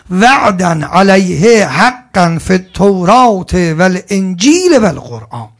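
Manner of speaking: slow, loud chanted recitation; Quran recitation. Real, not acted. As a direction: intone the verse slowly and fully. (تلاوت آهنگین قرآن)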